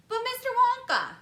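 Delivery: put-on high, loud eager boy calling out; boy's excited shout. A narrator's reading. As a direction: call out to Mister Wonka, bursting with excitement. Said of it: "he shouted".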